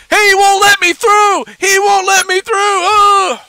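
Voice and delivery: very high, loud, drawn-out wail; hammed-up helpless yelling at bystanders; mocking yell. Host mimics wrestling rival whining to onlookers, mocking high yell. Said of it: "you start yelling around to other people".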